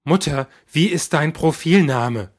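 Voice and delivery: loud, slow, strained demand; squeaky, breathless. Acted. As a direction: voice cracking with disbelief. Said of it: "Die Stimme quiekt bei den I's".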